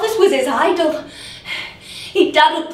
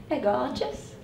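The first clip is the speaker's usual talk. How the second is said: quiet polite compliment; 9 dB quieter than usual. Acted. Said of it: "I said".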